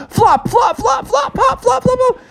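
very high, rhythmic hopping noises; storyteller making frog-hop sounds; squeaky flop sounds. Acted. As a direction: chirp each flop like a tiny frog bouncing.

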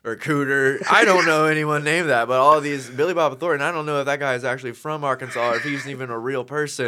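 high, loud folksy Arkansas joke; joking Southern storyteller. Retold or imitated. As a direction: tell it like a Southern comic. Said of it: "he makes this joke, and he's like".

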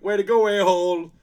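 high, very loud jeer; mock yell. Doctor jeering after the accident, mock yell. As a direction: shout it in mock annoyance.

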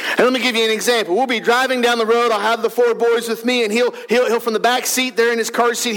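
ordinary talk, nothing marked

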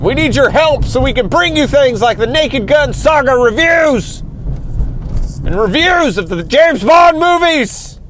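rising, pitch climbing into a hammed-up shout; mock upset rant. Host begging listeners, building to a mock shout. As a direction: plead for America like a desperate telethon host. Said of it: "I'm sorry to get so upset".

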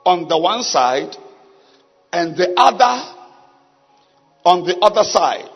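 slow, measured Bible reading; scripture reading. Acted. Preacher reading a Bible passage to the congregation, slow and solemn. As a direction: read it phrase by phrase with long pauses.

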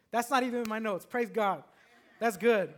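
normal talking voice

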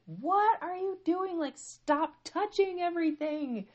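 high, loud, slow exasperated scolding; lashing out. Retold or imitated. Mom wanting to lash out at her daughter, exasperated scolding. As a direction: stretch each word in imagined fury. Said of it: "really just wanted to lash out".

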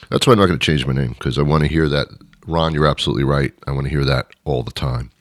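very low, soft, quick murmur; low confiding aside. Pretend. Host savoring the praise he wants to hear, low soft murmur. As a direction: drop far down and murmur it to yourself.

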